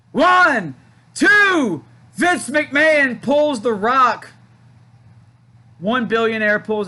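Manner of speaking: excited shout. Real, not acted. Live pin count, sudden interference.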